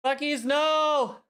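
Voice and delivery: stretched-out high wail of dismay, slow and loud; anguished cry. Real, not acted. Streamer wails at a raid gone wrong, drawn-out high cry. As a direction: cry out as if something terrible just happened.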